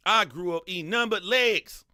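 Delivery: loud, high-pitched exasperated complaint; exasperated outburst. Real, not acted. Streamer ranting to viewers about childhood chicken, exasperated outburst. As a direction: holler it in comic exasperation.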